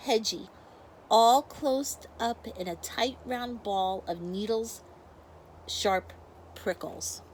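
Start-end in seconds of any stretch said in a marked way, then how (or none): none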